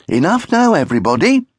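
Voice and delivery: high, loud mother voice; mother calling order. Acted. Mother settles the squabbling children, high and firm.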